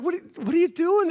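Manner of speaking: high-pitched indignant question; hammed-up annoyance; mad disciples. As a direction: scold them in mock disbelief. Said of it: "They get mad at the parents".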